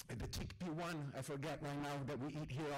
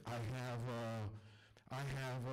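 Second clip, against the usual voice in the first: put-on Ahmed's accented English; 7 semitones lower than usual. Storyteller voicing his friend Ahmed at dinner, low accented English.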